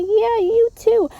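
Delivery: high, loud, slow "you too"; forced politeness. Acted. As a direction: smile through it to escape. Fake polite goodbye reply.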